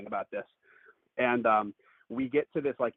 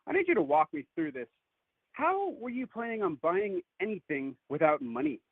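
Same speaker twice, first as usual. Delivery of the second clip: higher-pitched retold disbelief; incredulous questioning; 9 semitones higher than usual. Storyteller asking her date to explain the plan, incredulous.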